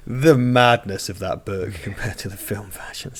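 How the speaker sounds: hushed unvoiced whisper. Real, not acted. Whispering to his co-host about the book's madness. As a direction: breathe it out as a secret.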